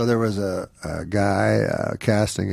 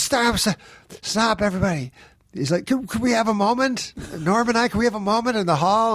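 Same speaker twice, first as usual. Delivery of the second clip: much higher, urgent voice cutting the reading off; exasperated casting director; 11 semitones higher than usual. Retold or imitated. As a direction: stop the room in a pitched-up panic. Casting director halts the audition.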